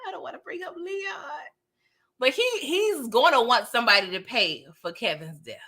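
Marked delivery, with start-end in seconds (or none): 0.1-1.4 dreading Leon. Real, not acted. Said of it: "I'm a little nervous".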